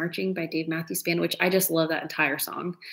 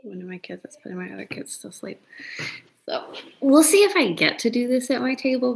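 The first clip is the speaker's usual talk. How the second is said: usual speaking voice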